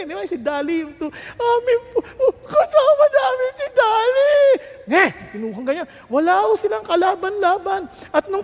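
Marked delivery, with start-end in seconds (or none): 1.4-4.3 high, loud tearful wail; loud sobbing shout, high pitched; crying shout. Retold or imitated. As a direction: cry out to keep the lamb. Poor man crying for lamb.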